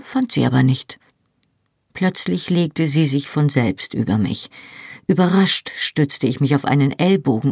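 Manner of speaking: ordinary delivery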